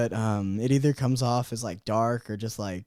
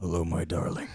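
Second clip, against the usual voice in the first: low, hammed-up endearment; put-on morning-voice greeting; mock deep suitor; 7 semitones lower, 4 dB quieter than usual. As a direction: croon it down low. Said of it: "and you're like".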